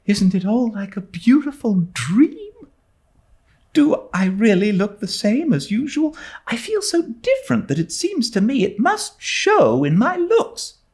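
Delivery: put-on a starry-eyed girl confiding her excitement; high dreamy schoolgirl wonder; young girl Anne. A narrator's reading. Anne voiced in story.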